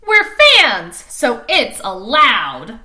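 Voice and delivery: loud, slowing down on allowed; crowd yelling. Acted. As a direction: declare it like obvious mob logic. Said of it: "the screaming crowd".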